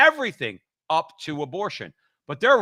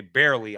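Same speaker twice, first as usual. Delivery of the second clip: "barely" set apart and emphasized; stress on BARELY; 6 semitones lower, 3 dB louder than usual. Real, not acted. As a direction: lean on the word "barely". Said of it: "I emphasize that".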